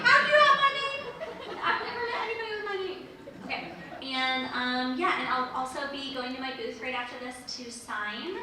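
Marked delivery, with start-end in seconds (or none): 0.1-3.1 high, loud, laughing disbelief; laughing squeal of disbelief; incredulous. Retold or imitated. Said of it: "I'm like".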